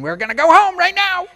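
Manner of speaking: put-on high, loud angry parent yelling at kids; mock parental yell. Family fighting at Disney World, mock angry yell. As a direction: snap at the kids like a fed-up parent. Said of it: "yelling at each other".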